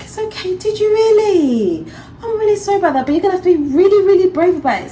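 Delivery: very high, sing-song reassurance; comforting mum. Retold or imitated. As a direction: coax the hurt toddler to be brave. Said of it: "I was like".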